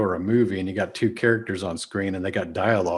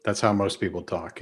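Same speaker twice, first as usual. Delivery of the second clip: usual speaking voice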